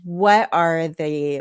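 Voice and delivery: ordinary talk, nothing marked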